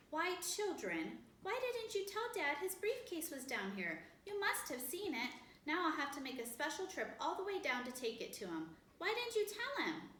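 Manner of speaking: put-on mother scolding her three children; exasperated scolding. A narrator's reading. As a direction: demand an answer from the kids.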